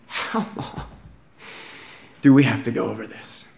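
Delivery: breathy, hushed groan of exasperation, then low; worn-out, muted exasperation; exasperated. Acted. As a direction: sigh it out quietly, at the end of your patience. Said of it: "He's exasperated".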